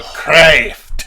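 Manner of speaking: craft as a loud, high "craaayft"; long A-E vowel. Shown as a demonstration. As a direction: belt out craft with a stretched "ay". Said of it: "something like a long A E sound".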